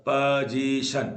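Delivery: Position drawn out in three beats; syllable by syllable. Shown as a demonstration. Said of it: "तीन बार टूटा word".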